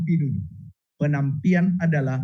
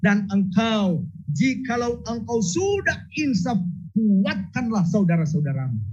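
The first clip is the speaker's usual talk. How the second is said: voicing Jesus; 8 semitones higher than usual. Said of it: "dia katakan".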